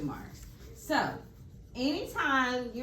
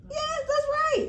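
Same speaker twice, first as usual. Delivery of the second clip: excited praise; 4 dB louder, slower than usual. Real, not acted. Praising a child's answer.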